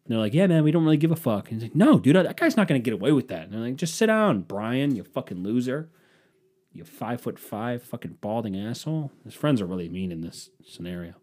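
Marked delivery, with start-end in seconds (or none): 0.3-1.5 mock bored friends. Friends voiced dismissively. 1.7-3.5 mock indignant insistence. Guy insists, voiced. 3.7-9.1 mean mocking friends. Mean buddies telling Brian to sit down, jeering. Friends mock him, voiced.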